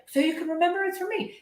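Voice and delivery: high, fast hammed-up Homer. Homer defending his selfish birthday gift. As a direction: rattle it off like a goofy husband. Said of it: "He's like".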